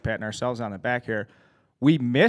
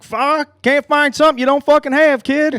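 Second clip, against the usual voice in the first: high, loud mock yell of an old hillbilly coach; gruff hillbilly coach; 9 dB louder than usual. Imitating the hillbilly pitching coach brushing off his struggling pitcher, high mock yell. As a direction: bark it like a drunk old coach cutting the kid down. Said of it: "fifty-year-old hillbilly from Central Illinois".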